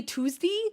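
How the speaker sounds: Tuesday ending in dee, TOOZ-dee; Southern Tuesday. Retold or imitated. Wondering about Southern speakers, questioning TOOZ-dee Tuesday. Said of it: "Every Southern person says it".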